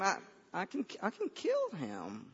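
quietly voiced determination; hushed resolve. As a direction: say it small and quiet, as young David. David's hushed claim to Saul.

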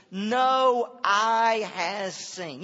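stretched out, each word slow and loud; drawn-out solemn quote; slow and weighty. Acted. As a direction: linger over every word. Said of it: "He says".

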